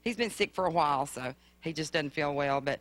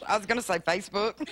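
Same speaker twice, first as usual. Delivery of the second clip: laughing; 5 semitones higher than usual. Real, not acted. Joking answer to host.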